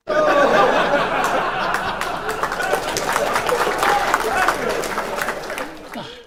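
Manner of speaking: long high laugh after the punchline; long laughing fit. Real, not acted. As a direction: crack up loudly at your own joke.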